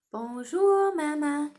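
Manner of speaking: loud, high Bonjour, Maman; cheerful choral French model; French greeting. Shown as a demonstration. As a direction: lead the class saying Bonjour, Maman. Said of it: "Let's say that together".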